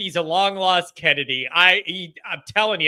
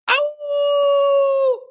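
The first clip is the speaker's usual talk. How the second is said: long, high, sustained howl; wolf howl. Pretend. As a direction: howl like a wolf on one held note. Wolf howl after name The Wolf.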